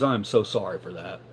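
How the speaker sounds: hushed, earnest sorry; soft apology. Retold or imitated. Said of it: "she goes".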